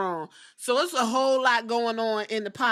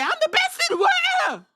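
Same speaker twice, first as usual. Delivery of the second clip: even higher, loud hammed-up boast; mock big shout; 7 dB louder, faster than usual. Repeating the wrestler's boast, shouted higher and louder. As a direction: belt it out like a wrestler's brag. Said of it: "He said".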